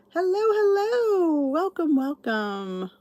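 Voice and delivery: high, slow singsong hello; greeting a viewer who just joined, singsong and slow. Real, not acted. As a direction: sing out the welcome to a newcomer. Greets new viewers joining stream.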